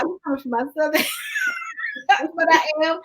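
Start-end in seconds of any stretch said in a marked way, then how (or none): 0.0-1.0 higher, words breaking into laughter; laughing mock protest. Real, not acted. Teased about accent, laughing. 1.1-2.3 very high, soft cackle; squealing laugh. Real, not acted. Teased about accent, laughing.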